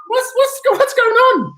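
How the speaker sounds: high, baffled squeak of a stranded man; mock bewildered. Stranded alone in the desert after the SEALs leave, high bewildered squeak. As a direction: play it lost and incredulous, voice jumping up. Looking around, abandoned.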